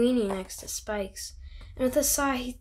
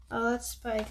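ordinary delivery

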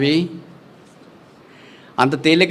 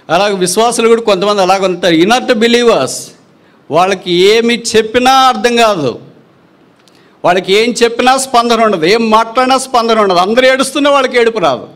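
much higher, louder pulpit exhortation; fervent preaching; 9 semitones higher, 5 dB louder than usual. Acted. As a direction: preach it with mounting fervour. Preaching at rising full force.

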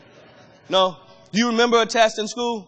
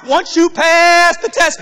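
preaching climax; 7 semitones higher, 5 dB louder, slower than usual. Acted.

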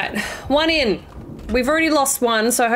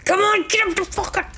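strained, pitched up with effort; straining effort; 10 semitones higher than usual. Real, not acted. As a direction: push the words out while straining. Straining to push object in game.

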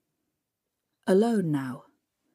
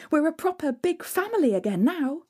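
put-on bright, higher, happy little girl; excited girl; 8 semitones higher than usual. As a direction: gush with joy about the new family.

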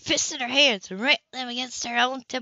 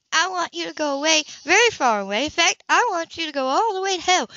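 higher, biting woman's voice; Anna, furious; 6 semitones higher than usual. A narrator's reading. Anna orders Cam to go away, angry. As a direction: tell him off.

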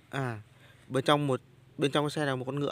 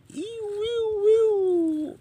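squealing high engine noise; high motor whine; 5 dB louder, much slower than usual. Pretend. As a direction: zoom it like a toy racing bike.

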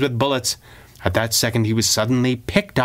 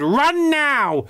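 high, urgent shout; high, insistent yell; urgent cry. Acted. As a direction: yell at them to flee. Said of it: "she cried".

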